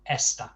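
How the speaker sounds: esta with the weight on ES; stress on first syllable. Shown as a demonstration. Teacher applying the nose rule, esta stressed on ES. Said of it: "is the first syllable".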